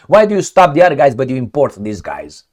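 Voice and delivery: normal talking voice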